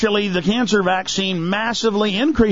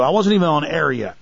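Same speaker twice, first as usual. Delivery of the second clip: ordinary delivery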